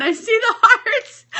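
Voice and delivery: ordinary talk, nothing marked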